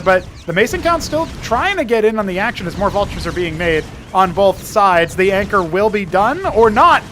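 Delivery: excited play-by-play. Acted. Live play-by-play.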